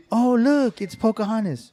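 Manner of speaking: hammed-up high girl voice. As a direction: squeal it like the surprised girl.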